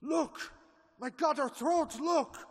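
high, loud alarmed shout; shocked yell at the wounds; horrified cry. Acted. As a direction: cry out in horror at the sight. Professor discovers bite marks, cries out.

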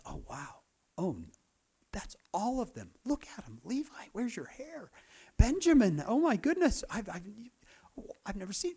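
hushed, breathless amazement, mostly whispered; awed whisper. Retold or imitated. Joseph secretly seeing his aged brothers, hushed awe. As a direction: marvel under your breath at the brothers. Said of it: "He's like".

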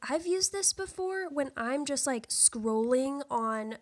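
normal talking voice

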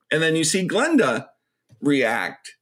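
ordinary delivery

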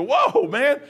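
Whoa, man blurted out high and loud; amazed exclamation. Retold or imitated. Preacher acting the believer's sudden wonder, high and loud. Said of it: "then you go".

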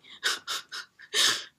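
breathy little laugh; real voiceless chuckle. Real, not acted. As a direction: laugh helplessly for no reason. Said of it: "I don't know, I'm laughing".

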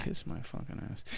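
unvoiced, very soft comeback; whispered retort. Real, not acted. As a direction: whisper the insult. Retort to being told stop.

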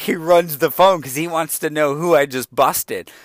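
ordinary talk

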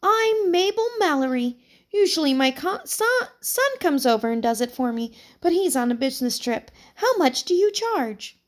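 put-on high, gentle elderly lady; old woman voice. A narrator's reading. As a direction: chat like a sweet old neighbour.

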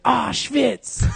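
high-pitched comic outcry; mock pained shout. Host pretending he stubbed his toe, mock high shout.

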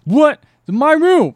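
high, loud outraged protest; guy shouting back at Nick Cage, high and outraged; indignant protest. Retold or imitated. Guy protests being thrown out.

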